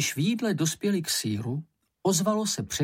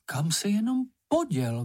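higher, exasperated question; flustered mother bursting out; agitated outburst; 8 semitones higher than usual. A narrator's reading. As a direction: burst out, pitch jumping up. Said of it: "vybuchla maminka rozčileně".

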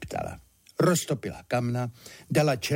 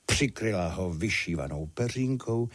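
plain reading voice

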